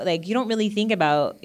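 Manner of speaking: normal talking voice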